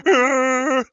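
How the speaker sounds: sudden loud angry shout; explosive chef scream; ramsay yell. Acted. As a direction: bark it out like a furious chef. Gordon Ramsay yells at someone.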